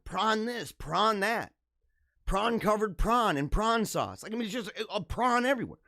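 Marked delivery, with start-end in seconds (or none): none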